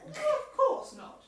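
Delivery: high, loud, slow affronted protest; indignant denial. Acted. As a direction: huffily deny it, offended at the very idea. Chair denies vanity when teased.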